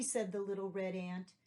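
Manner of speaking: ordinary delivery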